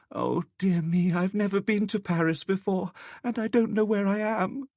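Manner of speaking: put-on high, fearful, tearful girl pleading; frightened girl sobbing. A narrator's reading. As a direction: beg a stranger not to hurt you, voice pitched high. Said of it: "He only heard her sob and stammer".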